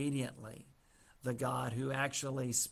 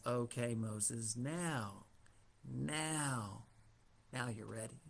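tender reassurance; 3 semitones lower, 8 dB quieter, slower than usual. Retold or imitated. God's tender closing word.

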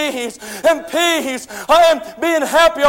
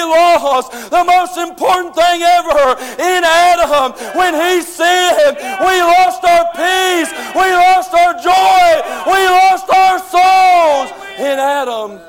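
slow, loud, higher cadence hammering each line; slow preaching shout; 4 semitones higher, 3 dB louder, slower than usual. Real, not acted. Preacher to the congregation about Adam, slow loud shouting. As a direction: pound out each loss slowly.

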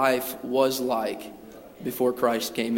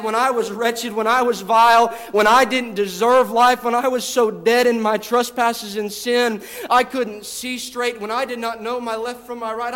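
higher, louder, rising sermon climax; full-force preaching; 6 semitones higher, 3 dB louder than usual. Acted. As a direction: build it up like a revival preacher.

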